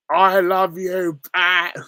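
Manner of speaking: loud, drawn-out Cockney soap anguish; Frank Butcher. Acted. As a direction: cry it out high and slow. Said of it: "that's Frank Butcher".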